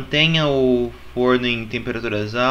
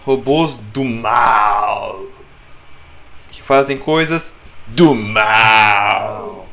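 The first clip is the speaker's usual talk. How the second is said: deep, slow and menacing; slow sinister villain growl; evil villain voice; 5 semitones lower, much slower than usual. Acted. Talking about evil robots.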